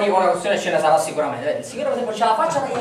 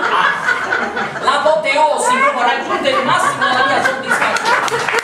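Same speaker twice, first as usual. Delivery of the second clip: much higher, louder, laughing triumph; ecstatic; 11 semitones higher, 5 dB louder, slower than usual. Acted. Boasting of his lifetime prize, he laughs in triumph. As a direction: crow with laughing delight.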